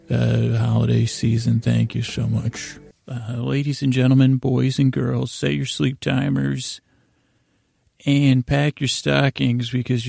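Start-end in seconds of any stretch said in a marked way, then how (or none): none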